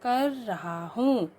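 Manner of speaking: very slow reading of the wrong sentence; slow dictation. Shown as a demonstration. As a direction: read each word slowly for pupils. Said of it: "शुद्ध रूप में कैसे लिखा जाएगा". (धीमा पाठ)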